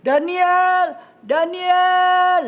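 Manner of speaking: loud, high, drawn-out call; king's searching holler; calling shout. Acted. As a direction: shout the name as if into a cave. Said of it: "dia teriak".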